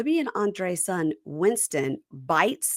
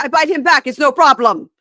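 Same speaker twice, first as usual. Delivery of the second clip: higher and louder bragging; mock Andre; 9 semitones higher, 11 dB louder than usual. Imitating Andre bragging about biting back, loud high. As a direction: boast it loudly, up high. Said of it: "Andre's like".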